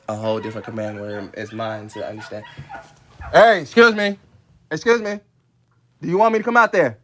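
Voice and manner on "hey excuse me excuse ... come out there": loud, high shout out the door; yelling. Real, not acted. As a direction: call out sternly to the dogs. Yelling at barking dogs outside.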